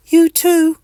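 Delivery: high, loud little call after him. Acted. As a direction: call out a cheery goodbye. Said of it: "calls Mister Itch".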